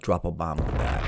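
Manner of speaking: low, soft deadpan drop; low catchphrase. Acted. Host lands his catchphrase low and soft.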